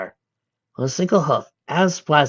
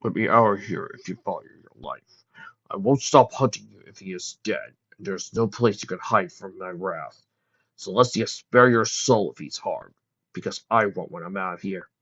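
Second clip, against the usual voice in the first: angry male voice; 3 semitones lower than usual. A narrator's reading. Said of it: "Lester was even angrier than Lucy".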